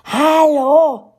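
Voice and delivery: high, loud calls after the bus; the story yelling that he forgot his umbrella; calling out. Acted. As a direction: shout after the departing man. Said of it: "schrie die Geschichte".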